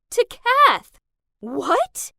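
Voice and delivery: loud, high disbelieving cry; stunned cry at the news of the wedding; shocked outburst. Acted. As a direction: gasp it out in disbelief.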